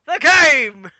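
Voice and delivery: high, loud ring announcer voice; announcer Vince. Retold or imitated. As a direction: boom it out like a ring announcer springing a surprise. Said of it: "Vince comes out and goes".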